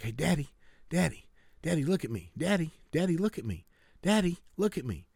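a small daughter pleading for attention; little girl begging. Retold or imitated. Little daughter calls to her father reading the paper, eager child voice.

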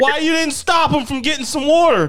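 high, indignant imitation of a mother; mock accusing mom. Mom questioning the friend who stayed, mock accusing. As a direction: demand an explanation, hammed-up. Mom interrogates second boy.

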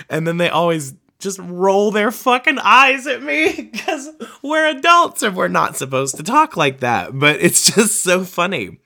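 high, loud, laughing through the words; laughing at the memory. Real, not acted. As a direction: giggle as you tell it. Laughing while telling.

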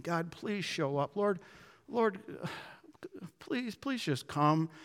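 hushed, desperate acted plea; pleading and begging. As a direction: beg softly, broken up by pauses and a sigh. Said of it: "they're just pleading and begging".

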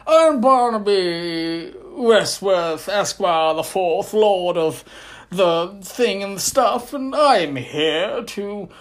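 loud, high hammed-up posh adventurer; pompous explorer. Vlogger imitating the ride's explorer character, hammed-up. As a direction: announce yourself like a grand explorer. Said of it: "he's like".

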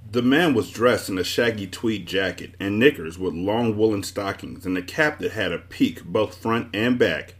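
usual speaking voice

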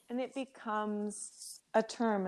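ordinary talk

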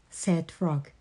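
plain reading voice

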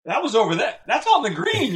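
mock exclaim. Retold calling out.

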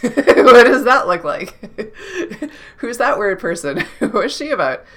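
laughing through the questions. Real, not acted. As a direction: chuckle while asking, amused at yourself. Laughing at own weirdness.

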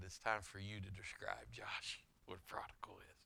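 hushed conspiratorial whisper. Retold or imitated. Pastor slyly nudging Josh to explain, whispered. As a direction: whisper it aside as a secret.

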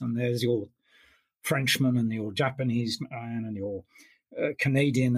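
ordinary talk, nothing marked

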